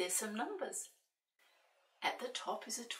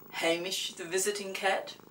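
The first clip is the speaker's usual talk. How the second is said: proud announcement; 7 dB louder than usual. Acted. Gentleman names the cat.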